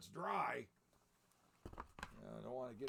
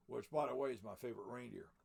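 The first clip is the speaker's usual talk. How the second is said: usual speaking voice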